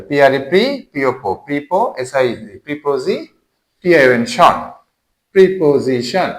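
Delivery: rhythmic letter names, each followed by its syllable; spelling by syllable. Shown as a demonstration. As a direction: spell each chunk, then join as প্রিপোজিশন. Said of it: "syllable করে".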